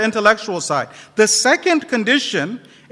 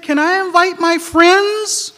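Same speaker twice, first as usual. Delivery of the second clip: put-on child voice; 8 semitones higher, 3 dB louder, slower than usual. A narrator's reading.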